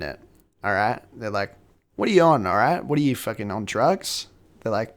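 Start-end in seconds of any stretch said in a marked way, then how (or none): none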